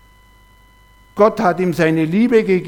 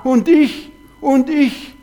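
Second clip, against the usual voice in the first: whiny inner voice; 7 semitones higher, 3 dB louder, slower than usual. Retold or imitated. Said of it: "die Stimme immer wieder da".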